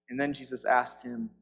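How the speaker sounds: ordinary talk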